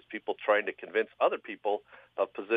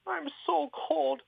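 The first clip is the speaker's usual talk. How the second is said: crying Sven. Acted. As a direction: whimper it out like a freezing, crying man. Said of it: "so he starts to cry".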